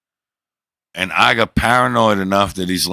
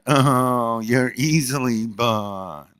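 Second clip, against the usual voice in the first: slow, higher mocking imitation of her; 5 semitones higher, much slower than usual. Teasing his daughter by mocking her voice, slow and higher. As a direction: drag it out to mock her sweetness. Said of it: "And in that voice, ... mock their voice".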